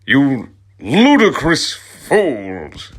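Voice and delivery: high, loud and slow mock outrage; hammed-up shout. Dumbledore scolding the two students, slow hammed-up shout. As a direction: drag out the insult like a pompous wizard. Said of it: "he shouted".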